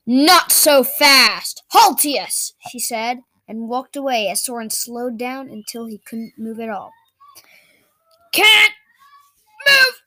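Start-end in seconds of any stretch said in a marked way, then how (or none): 0.1-2.5 slow commanding spell. Acted. Alia casts freezing spell. 8.3-10.0 strained cry. Acted. Frozen character strains.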